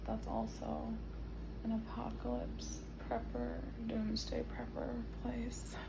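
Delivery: breathy whispered aside; whispering. Real, not acted.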